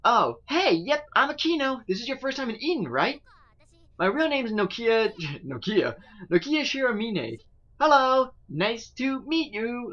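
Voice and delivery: put-on high, bright-eyed young girl. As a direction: voice Akino high and eager. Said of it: "I gotta do a voice for her".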